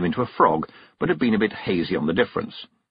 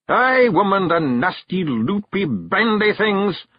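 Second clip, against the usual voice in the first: high, loud Scots grumble, dragging the last words; a wee warrior disgusted by letters; growling Feegle; 11 semitones higher, 4 dB louder, slower than usual. Acted. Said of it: "growled Rob".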